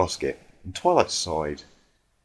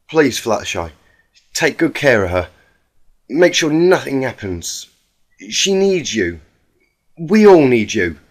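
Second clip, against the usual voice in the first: Applejack voice, earnest; 3 semitones higher, 6 dB louder than usual. Acted. Applejack entrusts Fluttershy.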